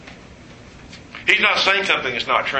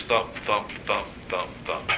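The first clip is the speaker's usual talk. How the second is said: thumps much softer and lower; fading heartbeat; 7 semitones lower, 10 dB quieter than usual. Shown as a demonstration. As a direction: let the heartbeat die away. Mimicking a heartbeat.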